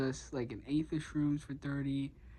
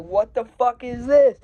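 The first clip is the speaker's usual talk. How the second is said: high, loud disbelieving yell; loud, high outraged cry of disbelief; outraged shout; 8 semitones higher, 14 dB louder than usual. Retold or imitated. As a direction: shout it out in disgust at the bad weed. Shocked at brown weed.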